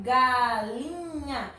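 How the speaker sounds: galinha drawn out, LI held long; stress on LI. Shown as a demonstration. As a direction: stretch galinha slowly to show the stressed LI. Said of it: "Qual é a sílaba tônica?". (galinha bem devagar, alongando o LI)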